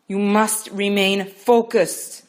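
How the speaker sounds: loud, slow and insistent; firm urging. Acted. Midwife urging Rachel, loud and slow. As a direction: drive each word home, slowly.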